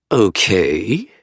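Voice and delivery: drawl. A narrator's reading. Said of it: "she drawls".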